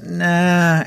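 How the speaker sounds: high, offhand brush-off; mock careless refusal; dismissive nah. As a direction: toss it off like it means nothing. Ahab retold dismissing God's command.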